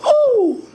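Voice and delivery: high, sustained owl hoot; long airy falsetto-style hoot. Shown as a demonstration. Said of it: "an owl goes".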